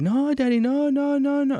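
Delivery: very high, sustained mock wail; high-pitched pleading of a screaming child, imitated; yelling child imitation. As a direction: mimic a kid's tantrum on one long high note. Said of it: "like, yelling more and more".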